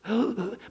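acted breathless gasps; quick mock gasping; imitated panting. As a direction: mimic a man stumbling out short of air. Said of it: "he's stumbling up".

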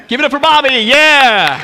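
loud hype-man shout; rallying cheer. Real, not acted. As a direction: whoop up the crowd to applaud. Rallying applause for Bobby.

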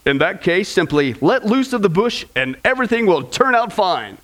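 ordinary talk, nothing marked

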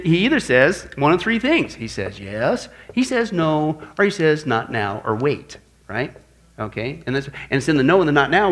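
normal talking voice

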